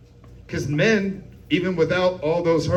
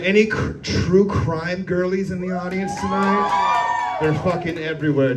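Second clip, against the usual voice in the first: normal talking voice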